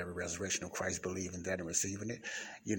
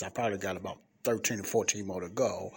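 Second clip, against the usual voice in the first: ordinary talk, nothing marked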